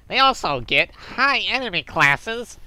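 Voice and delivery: pinched, nasal, with the nostrils held shut; nose-plugged voice. Acted. Reading Mister Happy's post aloud while holding his nose. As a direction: read it holding your nose like a smug poster. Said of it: "I should be plugging my nose".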